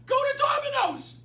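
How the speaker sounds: high, loud jeering send-off; mock shout. Mock order to non-believers.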